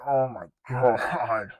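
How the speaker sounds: overwhelmed fan reacting to the finale; stunned. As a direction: stretch it out, stunned by the ending. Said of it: "I'm like".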